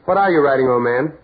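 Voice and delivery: calling out. Acted. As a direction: demand an answer from a figure who won't respond. Carl calls to silent old man.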